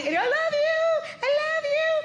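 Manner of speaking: put-on high, slow sweet little boy voice; little child voice. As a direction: coo it like a small child. Little boy voiced, mother imitates.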